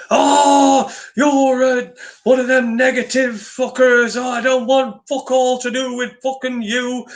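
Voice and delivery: high, put-on indignant rant, mimicking him; angry comeback, imitated. Blogger retelling how he came back at me, mock-indignant high voice. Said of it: "and he come back at me".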